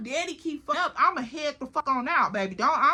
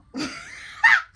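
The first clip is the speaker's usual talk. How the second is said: squealing laugh; high burst of laughter; 4 dB louder than usual. Real, not acted. Laughing at being stuck with her man.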